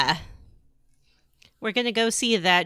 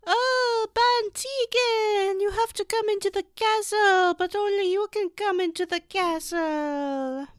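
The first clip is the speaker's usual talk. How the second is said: put-on theatrical pleading in an Orlesian accent; hammed-up Orlesian damsel, pitched way up; Orlesian woman; 12 semitones higher than usual. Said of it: "that's my Orlesian woman".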